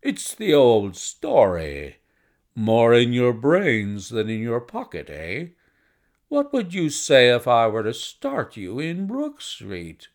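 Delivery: loud, slow, emphatic businessman; hearty gentleman with drawn-out words; bustling visitor. Acted. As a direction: make a bluff, confident proposition. Narrator voices the visitor Blessington.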